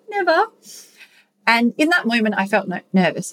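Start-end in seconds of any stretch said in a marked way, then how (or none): 0.1-0.5 much higher, stunned little never; high startled never to the doctor; wide-eyed shock. Retold or imitated. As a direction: squeak it out with eyes wide. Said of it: "I was kind of like".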